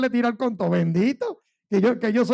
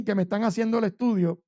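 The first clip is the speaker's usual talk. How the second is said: ordinary talk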